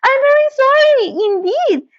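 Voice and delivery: high, fast, frightened apology. A narrator's reading. Dorothy to the melting witch, high fast frightened apology. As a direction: blurt it out scared. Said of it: "truly frightened".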